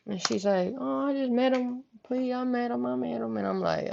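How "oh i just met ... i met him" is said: higher, softer imitation of the cousin; small, placating voice, repeating the plea; pleading cousin. Cousin explaining the new boyfriend, mock pleading.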